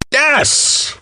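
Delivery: high exultant yes; thrilled high cry; triumphant yes. Acted. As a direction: cheer the big win.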